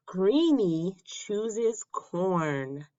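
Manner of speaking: ordinary delivery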